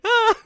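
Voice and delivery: high, loud "ah"; mock startled cry. Invisible teen suddenly reappears after being hit, startled cry. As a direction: blurt a comic startled yelp.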